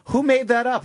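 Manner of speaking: high, confused ask; baffled question. Retold or imitated. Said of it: "So if you're like".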